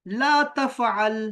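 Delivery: drawn-out ला तफ़अल, hit ला; teacher spells out the phrase slowly; slow recited Arabic. Shown as a demonstration. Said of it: "इसी तरह आएगा".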